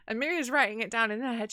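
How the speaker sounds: ordinary talk, nothing marked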